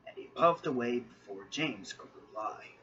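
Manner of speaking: usual speaking voice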